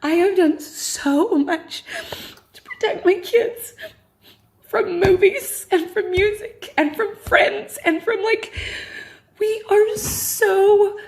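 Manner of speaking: earnest quote. Retold or imitated. Mother quoting herself to her husband, high and earnest. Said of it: "And I'm like".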